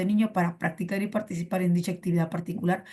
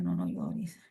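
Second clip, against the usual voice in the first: mock soothing; 7 dB quieter, slower than usual. Mock comforting a student.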